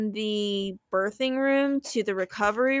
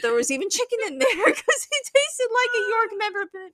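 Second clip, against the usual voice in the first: breaking into laughter mid-sentence, pitched much higher; laughing while talking; 11 semitones higher, 5 dB louder than usual. Real, not acted. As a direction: laugh through the words in disbelief. Hospital chicken salad tasting like mint.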